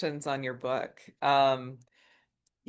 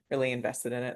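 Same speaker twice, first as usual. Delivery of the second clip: ordinary talk, nothing marked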